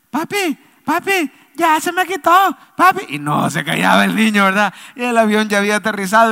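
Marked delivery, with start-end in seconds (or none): 0.1-3.0 put-on high-pitched, insistent child voice; little boy. Preacher as the boy telling dad it stopped, little-boy voice. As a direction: pipe up like an excited kid calling dad. Boy announcing pain is gone.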